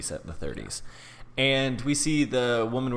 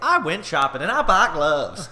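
oblivious chatterbox with a hammed-up Southern accent; 9 semitones higher, 10 dB louder than usual. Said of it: "I don't know why I did Southern".

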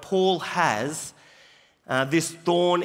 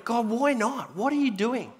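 higher-pitched pleading retold prayer; imitated exasperated plea; crying out; 5 semitones higher than usual. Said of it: "he cries out".

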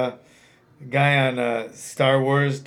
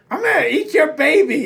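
much higher, put-on mock threat voice; Tyson threat impression; 12 semitones higher, 3 dB louder than usual. Tyson talking trash, hammed-up put-on voice. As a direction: ham up a silly threat. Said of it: "He's like".

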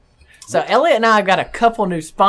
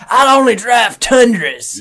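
slow, loud, higher proud declaration; mock die-hard fan; 8 semitones higher, 10 dB louder, slower than usual. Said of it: "That's like a".

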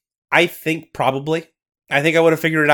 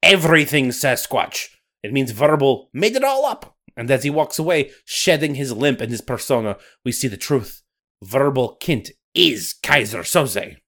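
put-on Scottish accent. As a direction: narrate like a Highland storyteller. Nessie narration.

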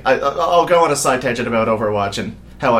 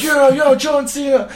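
crowd cheer; 13 semitones higher, slower than usual. Retold or imitated. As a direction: cheer like the whole arena. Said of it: "And everyone's like".

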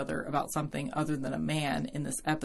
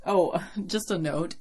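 normal talking voice

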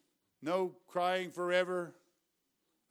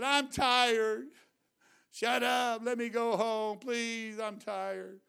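put-on higher and louder, fretful child-like voice fading at the end; Mitzi's complaint; 5 semitones higher, 5 dB louder, much slower than usual. Preacher imitating Mitzi calling out during service, high fretful voice. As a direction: act out a tired grown child complaining. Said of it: "she said".

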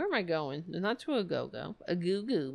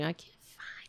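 whisper; 4 semitones lower, 9 dB quieter than usual. Real, not acted. Muttering to herself while searching.

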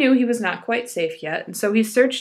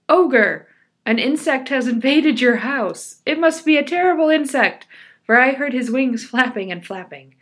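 loud, higher crying out; loudest voice; 3 semitones higher, 6 dB louder than usual. Acted. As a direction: call out loud to the ogre. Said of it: "cry out in his loudest voice".

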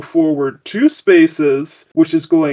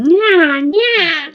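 high-pitched cutesy cat "nya"; cat-boy meowing; 12 semitones higher than usual. Acted. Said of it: "Except he starts saying".

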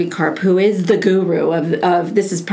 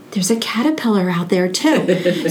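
mock wonder. Gasp of mock distraction.

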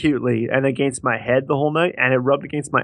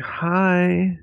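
high, mock-sweet phone voice; lovesick baby talk; 6 semitones higher than usual. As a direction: coo down the phone to your girlfriend. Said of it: "his voice went to this weirdest".

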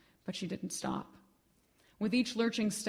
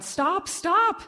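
rising, ever higher and louder cries; crying out; 11 semitones higher, 9 dB louder than usual. Acted. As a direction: scream it after her, climbing in panic. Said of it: "she cried".

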